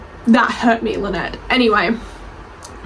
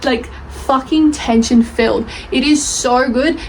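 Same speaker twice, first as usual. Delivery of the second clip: usual speaking voice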